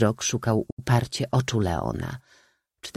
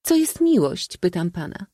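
very high, demanding question; insistent old maid; 10 semitones higher than usual. A narrator's reading. Old maid questioning the distracted count, insistent. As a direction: press for an answer in a high voice.

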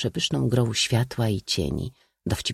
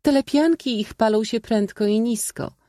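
very high, feminine remark; high lady's voice; 13 semitones higher than usual. A narrator's reading. Lady remarking on the peasants' burning huts, high-voiced. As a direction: lift the voice right up, ladylike.

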